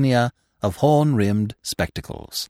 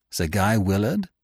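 ordinary delivery, nothing marked

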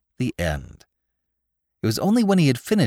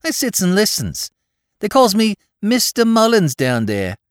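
higher, proud Bowery boast; a proud street kid bragging; with pride; 12 semitones higher, 5 dB louder than usual. A narrator's reading. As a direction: puff up over the fine name. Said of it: "said Spike with pride".

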